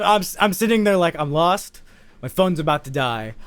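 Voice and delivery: normal talking voice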